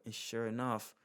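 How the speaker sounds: ordinary delivery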